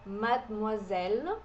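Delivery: Mademoiselle slowed down, the D dropped; slow French demo. Shown as a demonstration. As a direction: spell out Mademoiselle slowly as madmuazel. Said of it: "é como se esse D fosse mudo". (Mademoiselle devagar, D mudo)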